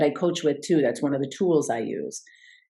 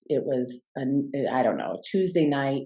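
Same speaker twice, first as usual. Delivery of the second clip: ordinary talk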